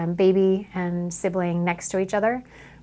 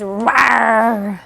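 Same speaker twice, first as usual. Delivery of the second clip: playful mock roar, not very big; dinosaur roar; 7 dB louder than usual. Photographer voicing dinosaur for toddler, mock roar. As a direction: growl like a toy dinosaur for a toddler. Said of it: "The dinosaur says".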